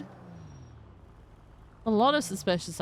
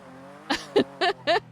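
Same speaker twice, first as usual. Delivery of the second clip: giggle; 10 semitones higher than usual. Real, not acted. Laughing at silly car.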